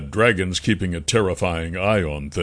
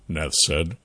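usual speaking voice

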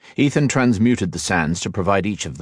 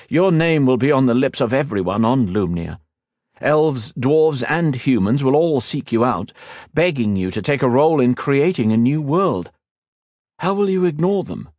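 higher, coaxing and eager; persuading cousin; 6 semitones higher, 3 dB louder than usual. A narrator's reading. Cousin urges the hero to lead, eager and coaxing. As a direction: lift him up, talk him into it. Liniel presses Ethan.

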